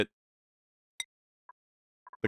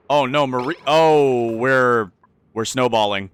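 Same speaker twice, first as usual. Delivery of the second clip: panicked; 7 dB louder, slower than usual. Real, not acted. Crisis spreading through the colony.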